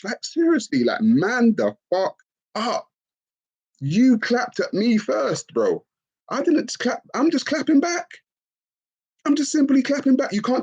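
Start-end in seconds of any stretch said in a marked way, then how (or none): none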